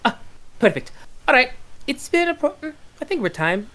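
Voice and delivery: high sing-song fey voice; chirpy fairy host wrapping up; high fey lady. Acted. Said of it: "She goes".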